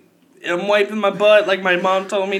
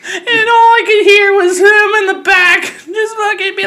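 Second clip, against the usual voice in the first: laughing; 8 semitones higher, 5 dB louder than usual. Real, not acted. Laughing through story.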